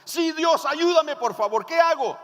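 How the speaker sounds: high, loud cry for rescue; desperate plea. Acted. Hanging man begging God for help, desperate.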